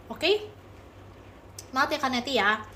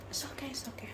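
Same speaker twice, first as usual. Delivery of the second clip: whispered softly to calm her; a gentle whispered reassurance; soothing whisper; 17 dB quieter than usual. Real, not acted. Comforting a crying viewer.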